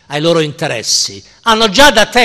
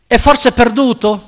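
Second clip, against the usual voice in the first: higher and louder, teasing question; mocking taunt; 7 semitones higher, 5 dB louder than usual. A market bystander mocks the madman, taunting. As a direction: jeer at the madman like a bored trader. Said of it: "e lo sfottano un po'".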